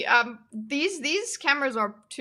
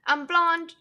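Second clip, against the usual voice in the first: lightly mocking, louder and higher quote; imitating a blonde singer; 5 semitones higher, 7 dB louder, slower than usual. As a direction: pipe it up as her catchphrase. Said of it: "she goes".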